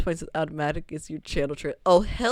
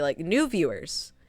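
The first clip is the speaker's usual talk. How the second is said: ordinary delivery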